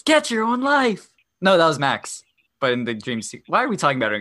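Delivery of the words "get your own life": high, slow mock yell; imitated boss yell. Re-enacting the boss's movie line, imitated yell. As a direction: yell it drawn out, quoting the boss.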